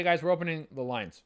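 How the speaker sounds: usual speaking voice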